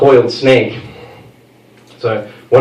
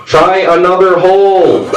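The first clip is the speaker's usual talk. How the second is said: yelled punchline; 6 semitones higher, 3 dB louder, slower than usual. Retold or imitated. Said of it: "did the one Tiger Woods line".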